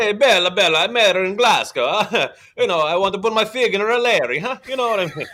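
loud, high hammed-up Italian Romeo; terrible Italian accent. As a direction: leer through a bad Italian accent. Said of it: "upset with terrible accent work".

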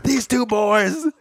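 high, laughing excited dad voice. Retold or imitated. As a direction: crow about two boys, laughing. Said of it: "he's like".

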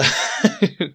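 sudden high laugh; cracking up mid-line. Real, not acted. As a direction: burst into a short laugh. Laughs after something falls.

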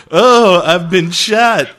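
loud, slow, laughing mock cry of pain; a laughing parody of a man yelping he's been shot; hammed-up shot man. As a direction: overplay a wounded bystander, giggling. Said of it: "Who does the total buckwheat of like".

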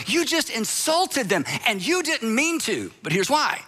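ordinary delivery

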